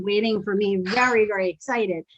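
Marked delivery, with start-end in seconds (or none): none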